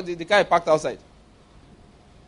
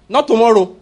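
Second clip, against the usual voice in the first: firm loud declaration; 3 semitones higher, 7 dB louder than usual. Acted. Emphatic resignation.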